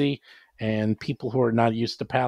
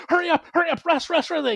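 much higher, rushed urging; urgent hurry-up. Retold or imitated. Game master rushing players to rest between waves, urgent high call. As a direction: hurry the party along like a clock is ticking.

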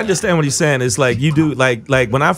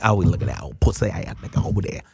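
low, softer imitation of slangy friends; mock street talk; 7 semitones lower, 7 dB quieter than usual. Imagined friends around her chatting, mimicked low and slangy. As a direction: drop it low, like her crew talking. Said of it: "You got some people like".

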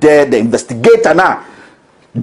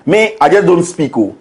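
usual speaking voice

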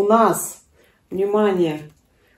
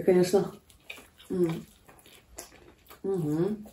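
chewing while talking; biting food mid-word; mouth full of pancake; 10 dB quieter than usual. Real, not acted. Seen 3: Woman bites pancake held at her mouth while speaking, then keeps chewing.

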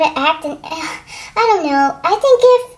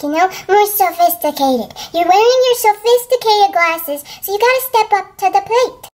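ordinary delivery

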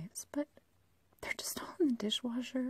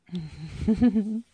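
creepy giggle; 11 dB louder than usual. Acted. Laughs after skin remark.

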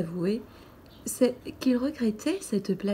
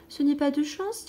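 «Ce n'est pas de chance» plus haut, montée sur «n'est»; un enfant qui plaint son ami, voix claire qui monte; petit prince compatissant; 6 semitones higher than usual. Acted. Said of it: "dit le petit prince". (le petit prince console l'allumeur, ton plus haut et montant)

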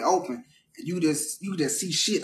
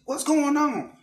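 startled mom; 9 semitones higher, slower than usual. Retold or imitated. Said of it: "she looking around".

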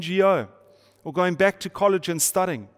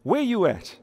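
sassy street challenge, higher first; with attitude; 6 semitones higher than usual. Shown as a demonstration. Pastor showing the congregation how to say it, with mild attitude. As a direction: toss it out with a bit of attitude. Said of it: "say with a little bit of attitude".

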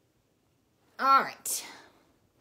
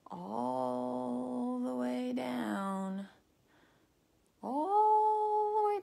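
long drawn-out words; 3 semitones higher, 8 dB louder, much slower than usual. Shown as a demonstration. Teacher showing kids the long counting caterpillar, long drawn-out words. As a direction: draw each word out as long as it goes.

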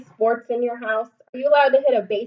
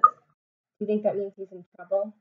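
ordinary delivery

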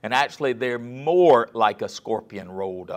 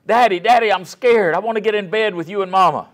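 put-on much higher, louder little-boy plea; scared little boy; 10 semitones higher, 9 dB louder than usual. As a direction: voice the frightened son begging his daddy. Scared little boy wakes his dad.

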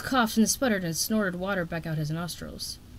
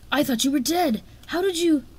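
high, astonished disbelief; surprised; 7 semitones higher, 5 dB louder than usual. A narrator's reading. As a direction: gasp it out, stunned. Shocked to see them alive.

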